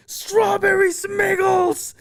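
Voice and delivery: very high, drawn-out goofy shout; imitated scream. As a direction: stretch each word into a piercing holler. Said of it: "and then I scream out".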